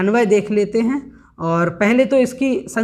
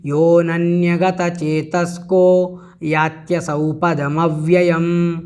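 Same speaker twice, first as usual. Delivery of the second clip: slow, measured chanting of the Sanskrit verse; slow devotional recitation; 4 semitones lower, slower than usual. Shown as a demonstration. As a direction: intone it slowly like a sacred recitation. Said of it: "इस प्रकार से इसका उच्चारण हुआ".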